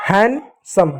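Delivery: हैंडसम split as han, sum; silent D. Shown as a demonstration. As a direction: break हैंडसम in two without d. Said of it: "हैंड नहीं होता है". (डी बिना "हैंडसम")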